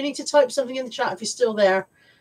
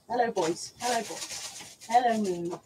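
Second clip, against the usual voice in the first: soft dog greeting; 9 dB quieter, slower than usual. Real, not acted. Greeting the dogs softly.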